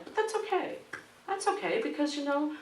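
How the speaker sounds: a beaten snake staying sunny; cheerful snake voice. Retold or imitated. Broken snake speaks to teacher.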